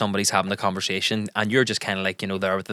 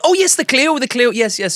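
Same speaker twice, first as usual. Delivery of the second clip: much higher, louder eager agreement; wife faking recognition, pitched up and loud; wife bluffing along; 6 dB louder than usual. Retold or imitated. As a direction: overdo the relieved yes. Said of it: "then she'd be like".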